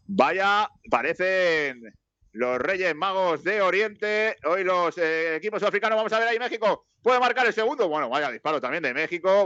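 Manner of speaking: high-pitched commentary building with the attack; live play-by-play. Acted. Commentator calls Mexico's chance for a second goal, high-pitched play-by-play. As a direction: climb in pitch as the chance develops.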